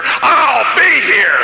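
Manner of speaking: stretched out, cracking shout, very slow; defiant shout. Retold or imitated. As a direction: fling it back as a vow. Final defiant retort to sergeant.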